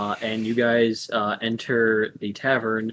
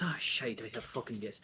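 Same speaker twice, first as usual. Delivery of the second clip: muttered curse; 3 semitones lower, 15 dB quieter than usual. Acted. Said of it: "She puts down the platter and goes".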